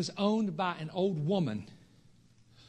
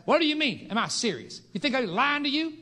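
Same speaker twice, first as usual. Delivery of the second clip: mock indignant, higher and louder; imitating a rebuke; 8 semitones higher, 5 dB louder than usual. Ruby rebuking him for doubting, mock indignant. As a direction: play the offended old woman scolding him. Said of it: "she'll rebuke you".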